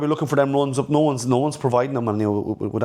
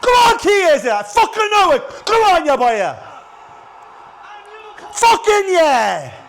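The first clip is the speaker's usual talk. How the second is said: screaming with joy at a goal; ecstatic shout; 7 dB louder, slower than usual. Real, not acted. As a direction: roar it out, over the moon. Fan celebrates late goal live.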